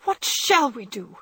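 lightly voiced frightened Joe; frightened sister. A narrator's reading. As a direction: fear for her sick sister.

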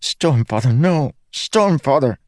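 high-pitched anguished exclamation; desperate cry. Acted. Kaladin as blood spurts between his fingers, desperate cry. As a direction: cry out in rising panic.

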